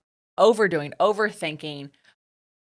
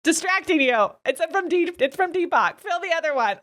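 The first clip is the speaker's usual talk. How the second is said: laughing, playful; 10 semitones higher than usual. Retold or imitated. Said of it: "I'm like".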